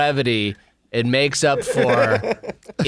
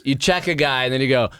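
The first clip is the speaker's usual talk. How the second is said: ordinary delivery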